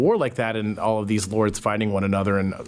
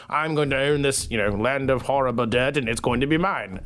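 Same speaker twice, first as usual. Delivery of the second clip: higher-pitched put-on British peasant accent; hammed-up British accent, staking a claim; 4 semitones higher than usual. Peasant survivor claiming land after the plague, mock British accent. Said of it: "that was my British accent".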